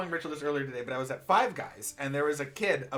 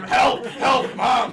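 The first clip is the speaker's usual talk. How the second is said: high, loud mock shout for help; imitated yelling kid; 9 semitones higher, 12 dB louder, much slower than usual. Player replays the kid at the counter, imitated yelling. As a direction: yell for Mom like a kid in need. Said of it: "he was just yelling until she got there".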